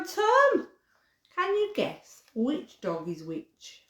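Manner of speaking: lively, sing-song picture book reading; storybook voice for little ones; reading to children. Acted. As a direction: read it to toddlers with bounce.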